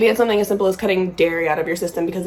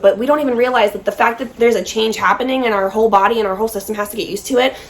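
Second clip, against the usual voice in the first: ordinary talk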